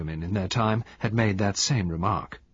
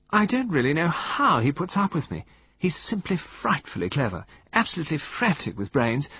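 sweet murmur; 9 semitones higher than usual. Acted. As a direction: coo it like a pampered wife. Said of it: "She murmured".

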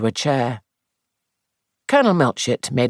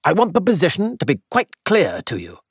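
ordinary delivery, nothing marked

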